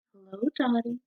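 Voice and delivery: soft, hushed little voice; whisper. A narrator's reading. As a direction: say it softly like a shy bird. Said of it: "whispers the quiet quail".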